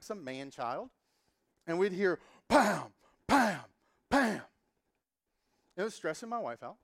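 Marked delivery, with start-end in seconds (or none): 2.5-4.4 louder, higher mock thumps; loud pounding noise. Imitating the neighbors pounding from below, loud mock thuds. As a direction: bang them out like fists on the ceiling.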